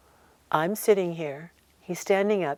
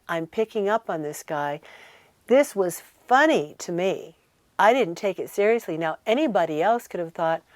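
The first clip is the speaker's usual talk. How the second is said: normal talking voice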